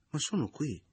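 startled at finding them; surprised exclamation. A narrator's reading. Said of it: "esclamò volgendosi verso Lisa".

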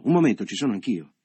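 loud sharp call to stop them; shouted. A narrator's reading. Michele shouting to stop the talk, loud. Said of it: "gridò".

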